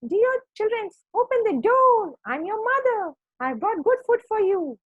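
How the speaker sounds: very high fake mother voice, projected; wolf imitating mother sheep. A narrator's reading. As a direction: call through the door in falsetto as a sheep. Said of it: "started to speak like the sheep".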